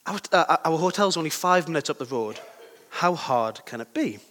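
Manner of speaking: normal talking voice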